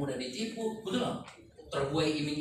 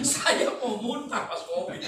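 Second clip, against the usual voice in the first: laughing; 8 semitones higher, 6 dB louder, slower than usual. Real, not acted. Laughing through the story.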